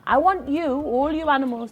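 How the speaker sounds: rally the crowd, chest out; proud announcement. Acted. Anansi proclaiming to the gathered animals, calling out.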